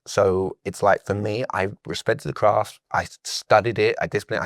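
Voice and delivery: ordinary talk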